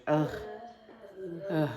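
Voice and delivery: schwa "Ugh" as a short grunted vowel; moody teenager sound. Shown as a demonstration. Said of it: "I call it the moody teenager sound".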